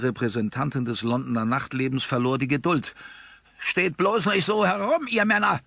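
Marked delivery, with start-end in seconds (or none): 3.6-5.6 high, shrill woman's cry; impatient, piercing call; shrill shout. A narrator's reading. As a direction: shrilly goad the men into action. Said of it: "rief sie mit schriller Stimme".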